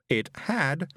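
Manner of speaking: ordinary delivery, nothing marked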